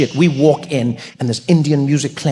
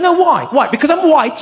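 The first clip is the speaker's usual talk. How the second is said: high upset protest; 12 semitones higher, 4 dB louder than usual. Retold or imitated. Steve shouting.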